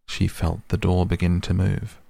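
plain reading voice

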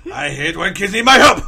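loud put-on complaining voice; mock grumbling master. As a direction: grumble loudly as a put-upon old teacher, played for laughs.

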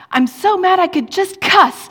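high, loud, hammed-up exasperation; mock frustrated outburst. A church friend venting her frustration, hammed-up and loud. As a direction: throw up your hands in mock rage. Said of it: "She was really frustrated".

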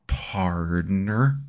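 a light mock American drawl; drawled partner. Shown as a demonstration. Mock American drawl ending a British line. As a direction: end on a lazy cowboy partner. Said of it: "at the end going".

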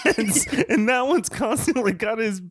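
high, exasperated complaint through laughter; flustered laughing protest. Real, not acted. Distracted podcaster complaining about creepy Santa shirt, flustered laughing protest.

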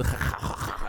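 voiceless guttural scraping, imitating German; back-of-throat rasp. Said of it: "a lot of back in the".

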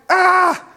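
loud, high "ah!"; mock exasperated cry. Preacher running late for a long class, mock cry of exasperation. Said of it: "And then it was like".

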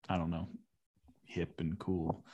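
ordinary delivery

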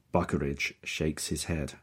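ordinary delivery

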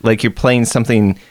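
ordinary delivery

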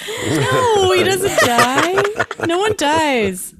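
high, slow insistent no; emphatic protest. Real, not acted. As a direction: draw out the denial loudly. Denies spoiler, laughing protest.

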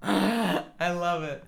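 delighted. Real, not acted. Slow delighted reaction.

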